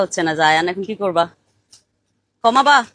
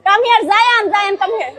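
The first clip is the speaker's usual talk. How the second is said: high, raised calling voice; calling over; 14 semitones higher, 7 dB louder, slower than usual. Real, not acted. Parent calling the child over at the party, high raised call.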